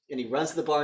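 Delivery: ordinary talk, nothing marked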